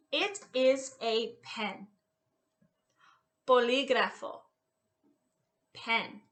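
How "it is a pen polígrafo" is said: normal talking voice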